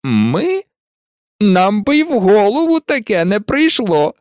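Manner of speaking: high, exaggerated mock innocence; beaming delight. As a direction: protest with delighted, put-on innocence. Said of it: "аж засяяв Фред". (сяючи від захвату)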